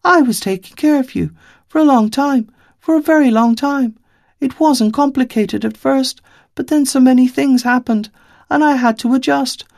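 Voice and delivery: put-on high little girl, earnest and a bit defensive; a child insisting she did her best; young girl's voice. Little girl telling Five she cared for him, high and earnest.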